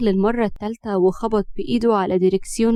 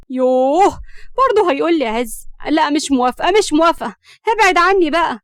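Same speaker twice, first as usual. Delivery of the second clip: high, loud exasperated refusal; 5 semitones higher, 5 dB louder than usual. A narrator's reading. Hams refuses to marry Ezz, exasperated. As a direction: push him away with angry insistence.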